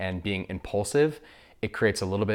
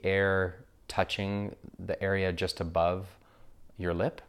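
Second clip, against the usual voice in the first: guided meditation. Acted. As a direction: guide listeners gently into breath awareness, unhurried.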